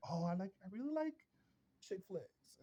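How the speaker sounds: high, soft falsetto mockery; mocking feminine voice. Mocking a man who copies the girls, high soft falsetto. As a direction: sneer at him with a high sugary voice.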